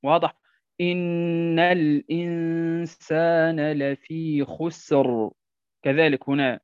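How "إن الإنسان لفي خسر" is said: slow, measured recitation with held nasals; teacher demonstrating tajweed; Quran recitation. Shown as a demonstration. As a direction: recite the verse, drawing out the long sounds.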